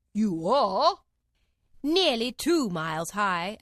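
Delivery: loud, slow accusing; stern royal voice. A narrator's reading. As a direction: accuse her haughtily. King and queen accuse Alice.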